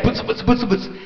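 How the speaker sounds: mumbled grumble noise, trailing away; soft mock grumbling. Preacher mimics believers judging each other, soft mock mutter. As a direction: mutter it under the breath.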